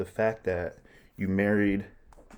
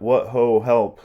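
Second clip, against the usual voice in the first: loud alarmed call, higher pitch; Polonius calling for help; 4 semitones higher, 9 dB louder, slower than usual. Acted. Polonius shouting for help from behind the curtain. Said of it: "or Polonius here".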